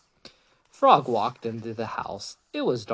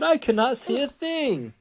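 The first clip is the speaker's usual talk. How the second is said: high-pitched storybook complaint; mock distress; 11 semitones higher than usual. A narrator's reading. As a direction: wail it high, acting helpless. Toad blinded by sun, read aloud.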